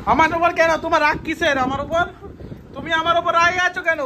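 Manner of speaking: high-pitched, insistent questioning; insistent pleading. Pretend. Son asking mother why she is angry, high pleading.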